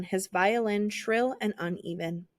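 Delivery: ordinary delivery, nothing marked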